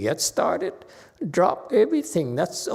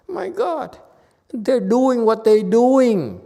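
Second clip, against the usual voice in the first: exasperated outburst; 5 semitones higher, 6 dB louder, slower than usual. Real, not acted. Exasperated over world news.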